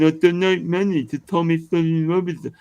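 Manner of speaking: high, whiny mock supporter voice; mocking gullible fans. Streamer mocking Tommy's fans in a high whiny voice. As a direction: sneer it out like a doting fan. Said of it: "and then people say".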